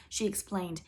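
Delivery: ordinary delivery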